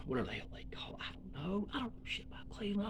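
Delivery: hushed throwaway whisper; whispered aside. Real, not acted. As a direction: drop to a whisper under your breath. Muttered aside to himself.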